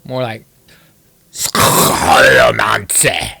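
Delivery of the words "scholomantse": very low, slow, guttural German caricature; hammed-up German, throaty start and a punched extra syllable at the end; mock German accent. Streamer tries again at a German pronunciation, exaggerated mock German accent. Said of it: "what the Germans pronounce it".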